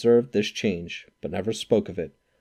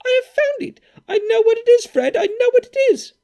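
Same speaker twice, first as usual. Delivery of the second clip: very high, loud triumphant shriek; excited cry; 7 dB louder than usual. A narrator's reading. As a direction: burst out with the answer. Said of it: "cried out".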